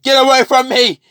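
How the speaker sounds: high, loud cry to back off; acted frightened yell; panicked shout. Villain scared of Carnage.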